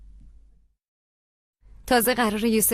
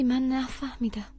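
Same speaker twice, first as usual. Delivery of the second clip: slow whispered confusion; 7 dB quieter, slower than usual. Acted. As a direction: barely voice it, slowly. Daughter confused by mother's anger.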